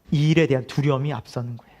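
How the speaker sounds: ordinary talk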